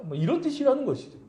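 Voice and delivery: ordinary talk, nothing marked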